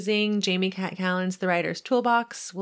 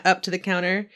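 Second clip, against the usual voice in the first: usual speaking voice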